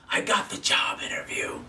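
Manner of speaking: voiceless whisper of triumph; whispered aside. Retold or imitated. Grateful viewer confides his success to the host, whispered. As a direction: whisper it like a delighted secret.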